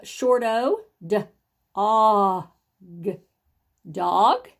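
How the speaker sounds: slow, segmented phonics sounding-out of "dog". Shown as a demonstration. Teacher sounds out a word for young readers, slow sounding out. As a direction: stretch the short O in "og", then blend to "dog". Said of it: "after we've sounded it out".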